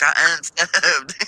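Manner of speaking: slow, drawn-out I unsubbed; slow deliberate quote. Retold or imitated. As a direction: stretch it out proudly. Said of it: "He's like".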